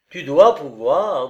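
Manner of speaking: slow, loud, firm insistence. A narrator's reading. Lover insisting to Olga that she can, firm. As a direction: state it firmly, weighing each word. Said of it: "d'une voix basse mais ferme".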